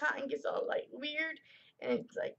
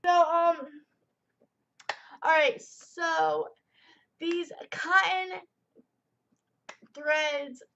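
still teary; 2 semitones higher, 7 dB louder, slower than usual. Real, not acted. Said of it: "I get so teary-eyed".

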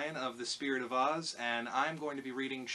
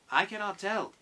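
raised, loud character voice; Tin Woodman; 9 semitones higher, 9 dB louder than usual. A narrator's reading. Tin Woodman answers the child, raised character voice. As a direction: pitch it up and project as the Tin Woodman.

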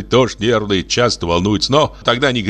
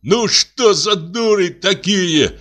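high, drawn-out exasperation; exasperated grumbling; 9 semitones higher, slower than usual. A narrator's reading. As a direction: complain slowly with raised pitch. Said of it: "повторил он уже на кухне".

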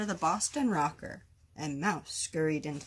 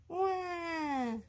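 put-on high, drawn-out baby "wah"; sustained high-pitched mock baby cry; baby wail; 11 semitones higher than usual. A narrator's reading. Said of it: "yelled the baby ghost".

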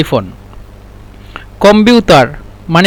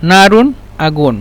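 ordinary delivery

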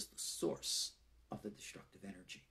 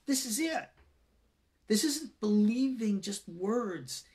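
raised, higher urgent exhortation, slowing at the end; fervent preaching; 8 semitones higher, 6 dB louder than usual. Acted. Teacher urging online students to act, preaching fervently. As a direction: preach it home, building to a plea.

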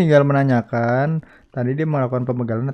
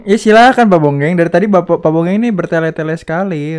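loud, higher, fed-up call to order; exasperated shout; 3 semitones higher, 6 dB louder than usual. Real, not acted. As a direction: raise your voice to cut through the stalling. Moderator losing patience.

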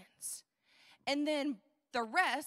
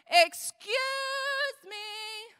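loud, stretched-out "excuse", higher "me"; interrupting heckler; 7 semitones higher, 7 dB louder, slower than usual. Acted. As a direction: call out to butt in on the teacher. Heckler interrupts Jesus mid-teaching.

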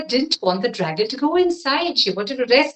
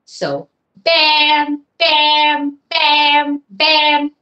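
knocking sound; 4 semitones higher, 4 dB louder, much slower than usual. A narrator's reading. Knocking sound on cave door.

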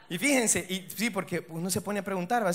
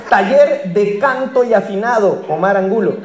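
mock announcement; 13 dB louder than usual. Anuncio burlón de maestros falsos.

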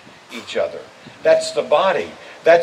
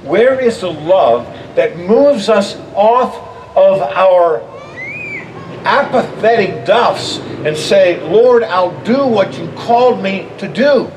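loud, slow, rising preacher's plea; loud preaching; 4 semitones higher, 6 dB louder, slower than usual. Real, not acted. Preacher calling the church off apathetic duffs, loud and slow. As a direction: call them out of apathy at full force.

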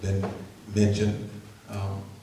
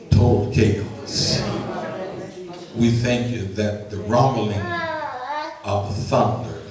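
steady, measured public prayer; earnest prayer; 5 semitones higher, 7 dB louder than usual. Acted. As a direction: pray aloud before the congregation, deliberate and sincere.

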